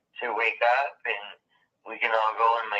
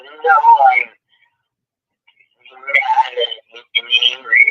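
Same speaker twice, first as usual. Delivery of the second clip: mock angry, louder, imitating Kelly; imitating an angry man; 12 dB louder, slower than usual. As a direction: retell his grumpy reply with some bite. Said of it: "Kelly sounded like he was in a bad mood".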